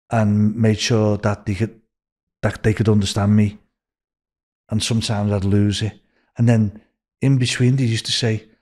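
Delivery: ordinary delivery, nothing marked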